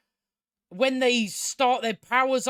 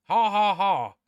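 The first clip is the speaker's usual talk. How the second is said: deadpan, spelled-out "ha ha ha"; sarcastic fake laugh; 3 dB louder than usual. Reviewer mocking what the audience should laugh at, sarcastic fake laugh. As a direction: fake a laugh to show it isn't funny. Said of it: "the audience is supposed to laugh at this. It's not actually funny".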